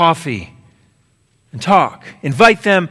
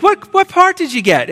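mom's eager question; 12 semitones higher than usual. Retold or imitated. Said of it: "She's like".

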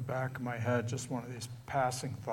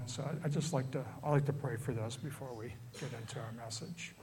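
ordinary delivery

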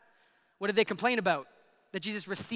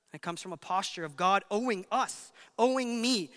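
ordinary talk

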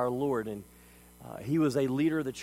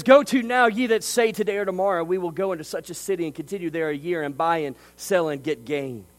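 forceful preacher's reading of scripture, louder; 2 semitones higher, 5 dB louder than usual. Acted. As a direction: proclaim the verses to the congregation. Reads scripture aloud with force.

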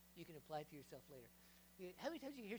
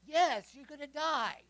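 very loud, slow, higher pronouncement; stern verdict; 7 semitones higher, 25 dB louder, much slower than usual. Acted. God answering the complaining people, slow loud verdict. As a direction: boom it out slowly like a final judgment. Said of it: "God says".

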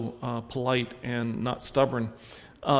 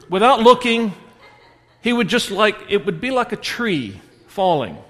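animated telling; 5 semitones higher, 7 dB louder than usual. Acted. Describing son's tantrum fall.

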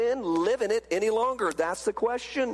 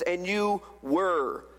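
slow, with "were" drawn out; "were" held before a pause; stress on were; 3 semitones lower, slower than usual. Shown as a demonstration. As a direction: linger on "were" to mark the past. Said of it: "Notice the past tense".

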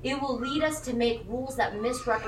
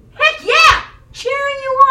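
much higher, loud triumphant whoop; cheering shout; 12 semitones higher, 11 dB louder than usual. Pretend. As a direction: cheer like your kid just did it. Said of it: "cheering on my children for".

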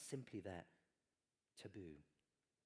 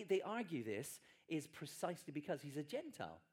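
ordinary delivery

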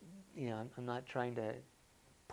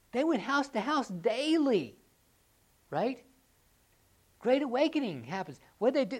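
raised, loud preaching that leans hard on daily; fired-up preaching; 8 semitones higher, 9 dB louder than usual. Acted. Pastor urging the congregation to meet daily, loud fired-up preaching. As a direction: preach it out full force to the room.

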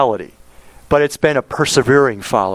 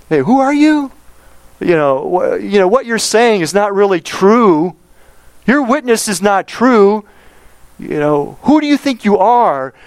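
mocking critics; 7 semitones higher than usual. Critics challenge Jesus in temple.

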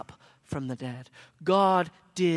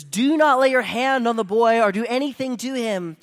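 shout; 6 semitones higher, 10 dB louder than usual. A narrator's reading. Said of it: "a voice shouts".

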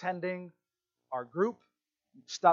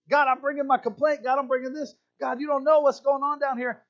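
higher, mock aggrieved complaint; complaining prayer; 7 semitones higher, 4 dB louder than usual. Believer griping to God about troubles, mock complaining. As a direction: bring it to God like a grievance.

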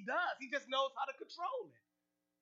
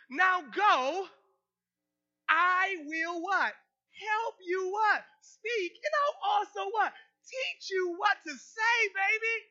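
higher and louder, building; hammed-up shouting verse, higher and louder; shouted preaching; 5 semitones higher, 6 dB louder, slower than usual. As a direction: preach it at full force.

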